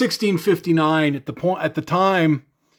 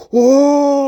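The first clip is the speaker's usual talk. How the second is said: much higher, louder mock amazement; amazed whoa; 10 semitones higher, 6 dB louder than usual. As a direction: ham up the kid's awe. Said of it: "he's like".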